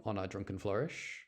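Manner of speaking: usual speaking voice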